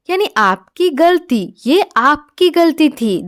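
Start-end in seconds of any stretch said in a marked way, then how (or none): none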